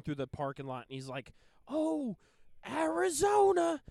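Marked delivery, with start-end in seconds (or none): 1.7-3.8 high, slow Philly sports fan cheer; Philly accent. Retold or imitated. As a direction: belt it out in a Delco accent. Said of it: "in his Philly accent".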